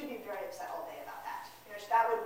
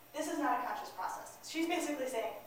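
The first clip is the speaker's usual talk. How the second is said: normal talking voice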